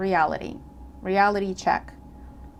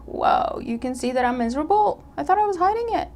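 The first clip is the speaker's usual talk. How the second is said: startled realization; 7 semitones higher than usual. Retold or imitated. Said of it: "And they're like".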